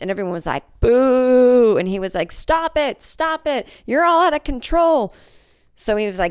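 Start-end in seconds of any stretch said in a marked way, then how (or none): 0.8-1.8 loud high mock booing; crowd boo. 2.3-5.1 high, flustered scolding; flustered scout. Retold or imitated.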